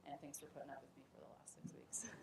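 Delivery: quick, breathy near-whisper; teasing whispered thanks to the class; hushed aside. Real, not acted. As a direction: slip it in under your breath. Teacher's joking aside to class.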